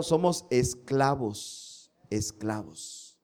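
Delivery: esclavos said slowly and dropping away; slow, falling stress on esclavos; slow emphasis. Real, not acted. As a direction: lean hard and slow on esclavos. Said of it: "para que quede más claro".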